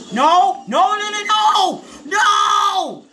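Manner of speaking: high, loud wailed noes; anguished screaming. Real, not acted. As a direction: scream it in dismay, each no stretched. Boss fight going wrong.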